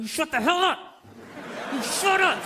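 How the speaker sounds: rough mock command, rising in pitch; angry boyfriend. Man gripping his girlfriend on the train, mock-angry order. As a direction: imitate a man ordering her silent. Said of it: "He's going".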